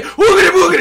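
high, loud spooky gibberish; mock jump scare. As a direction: pounce with a silly high boo. Imitating prank video jump scare.

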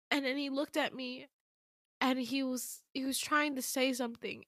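high, soft, choked-up retelling; tearful. Real, not acted. Storyteller moved by the man in her dream, tearful. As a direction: hold back real tears while continuing. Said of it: "just so emotional".

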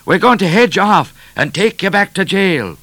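high, loud threatening shout. A narrator's reading. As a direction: yell the threat across the chase. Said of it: "shouted O'Grady".

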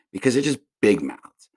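ordinary talk

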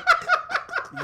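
real high-pitched laugh; high burst of laughter. Real, not acted. Laughing at his son's word.

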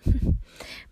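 light unvoiced titter; giggle. A narrator's reading. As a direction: giggle like an amused old woman. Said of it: "Sie kicherte".

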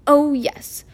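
clipped, quick and sarcastic; impatient. A narrator's reading. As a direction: snap it out, fed up. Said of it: "she said impatiently".

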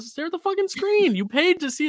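high, loud, fed-up yell; shouting in exasperation. Real, not acted. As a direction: yell it at the phone filmers. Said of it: "repeats it louder".

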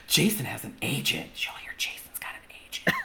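mock rumor, low and soft sinking into a whisper; gossipy whisper. Schoolmates spreading word of his agent, gossipy whisper. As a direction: pass it along as hushed school gossip.

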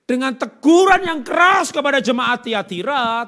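very loud, high, slow declaration; full-force preacher, stretching the key words; thundering preaching. Acted. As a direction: hammer it out to the congregation.

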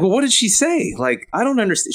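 high, baffled retold reply; bewildered protest. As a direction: plead that you don't follow. Retelling his confused reply to the parent.

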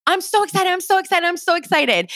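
high-pitched, excited chant to yourself; excited self-talk. Acted. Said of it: "just trick your mind, hack your mind".

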